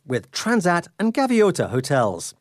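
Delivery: high, energetic welcome; upbeat travel show host. Acted. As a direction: greet listeners with a lift. Host opens segment.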